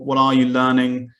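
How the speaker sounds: ordinary talk, nothing marked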